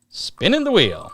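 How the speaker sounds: high, excited game-show call; hyped giveaway announcement. Acted. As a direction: hype up the wheel spin. Giveaway wheel spin.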